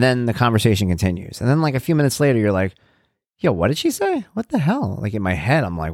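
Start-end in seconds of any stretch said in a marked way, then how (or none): 3.4-5.0 high-pitched baffled double take; mock incredulous, voice jumping up; delayed disbelief. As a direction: act out a belated inner "wait, what?". Delayed inner reaction, acted out.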